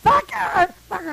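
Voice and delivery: high, loud mock scream; retold scream. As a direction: scream it out as the furious woman in the story. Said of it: "screams out".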